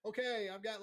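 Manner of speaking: high, loud talk-show host hype; Oprah-style giveaway. Retold or imitated. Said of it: "felt like we were on Oprah".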